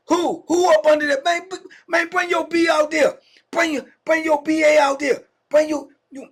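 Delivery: high, loud furious demand; angry yelling. Retold or imitated. Returning cellmate yelling at the hidden man, high angry shout. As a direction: bark at him to come out from under the bed.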